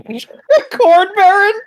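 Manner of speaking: high squeal of laughter; laughing. Real, not acted. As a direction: crack up at the words. Laughing at corn baron.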